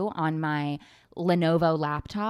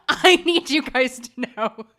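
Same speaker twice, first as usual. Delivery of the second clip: louder through laughter, higher; giggling helplessly; laughing; 7 semitones higher, 7 dB louder than usual. Real, not acted. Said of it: "I'm going crazy".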